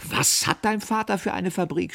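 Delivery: high-pitched, aghast question; shocked, shrieked query; horrified cry. A narrator's reading. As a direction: cry out in disbelief. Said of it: "schrie ich entsetzt".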